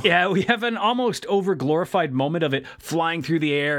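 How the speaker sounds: ordinary talk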